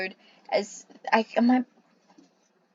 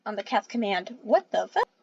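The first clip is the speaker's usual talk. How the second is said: plain reading voice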